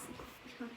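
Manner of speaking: a soft breathy laugh under the breath; hushed giggle. Real, not acted. Reader giggling at the mascot names, a hushed laugh. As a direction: giggle quietly. Giggle during shared reading.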